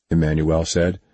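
ordinary delivery, nothing marked

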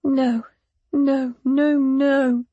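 understated tearful disbelief; a touch of grief in each word; repeated denial. A narrator's reading. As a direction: keep refusing it, close to crying. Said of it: "repeating the word over and over".

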